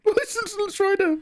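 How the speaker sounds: high, slow blubbering cry; drawn-out, squeaky sob of the name; tearful wail. Retold or imitated. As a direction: wail the hero's name through tears. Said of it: "I was just like".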